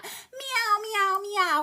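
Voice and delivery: high, mocking meows; cat meows. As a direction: mew like a sly cat. Imitates cats inside the poem.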